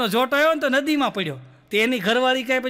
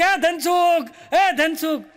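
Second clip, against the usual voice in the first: calling out; 4 semitones higher, much slower than usual. Retold or imitated. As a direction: shout the name after him as the train pulls away. Uncle calling out from moving train. (દૂરથી બૂમ પાડીને બોલાવવું)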